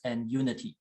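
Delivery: ordinary talk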